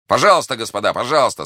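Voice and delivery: loud, high invitation to proceed; loud speech. A narrator's reading. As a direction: speak up for everyone to hear. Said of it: "сказал громко Д'Артаньян". (громко, высоким голосом)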